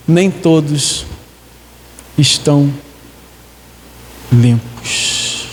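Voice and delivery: "Nem todos... estão... limpos" low, with long pauses; pointed pauses. Acted. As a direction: slowly drop "limpos" away to a near whisper at the end. Requotes verse, dramatic pauses.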